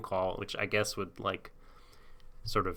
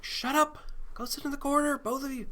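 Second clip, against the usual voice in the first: much higher, scolding voice; exasperated Ash. Retold or imitated. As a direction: snap at them like a fed-up parent. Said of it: "Ash is just like".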